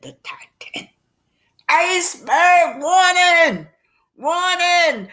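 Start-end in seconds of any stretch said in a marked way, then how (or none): 1.7-5.1 high, loud and slow, calling out a warning; radio operator shouting an iceberg warning to ships; urgent alarm call. A narrator's reading. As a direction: sound the alarm over the radio. Radio operator sending ice alarm.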